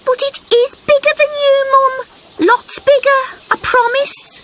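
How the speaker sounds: high, fast, pleading insistence; desperate to be believed; little frog voice. A narrator's reading.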